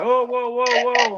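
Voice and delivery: high, loud alarmed shout of whoa. Retold or imitated. Yard worker running out to stop the driver, high alarmed yelling. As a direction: call out like a guy rushing over to stop a truck. Dude runs out waving arms.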